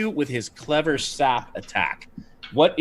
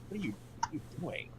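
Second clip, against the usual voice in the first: much softer, fast mutter under the breath; muttered aside; 2 semitones higher, 18 dB quieter, much faster than usual. Acted. Anduriel catching the mace, a soft fast mutter. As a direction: toss it off quickly and quietly. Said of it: "be like".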